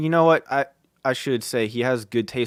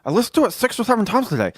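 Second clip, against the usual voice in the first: high, gushing mock-buddy voice; Fonzie impression; 10 semitones higher, 5 dB louder, faster than usual. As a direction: gush as your friend, pitched way up. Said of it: "he was messaging me about it".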